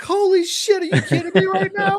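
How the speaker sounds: very high, loud stunned outburst; retold shriek of amazement; acted disbelief. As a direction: exclaim in hammed-up astonishment. Acting out own shock in media center.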